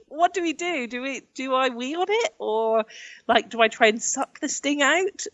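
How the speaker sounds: mock-panic about what to do next; retold panic. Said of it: "So I was like".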